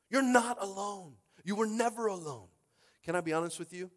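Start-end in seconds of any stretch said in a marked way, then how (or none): 0.1-2.5 loud but slowed, leaning on each word; slow it down and land it; slower emphatic repeat. Acted. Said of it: "repeats line as contrast".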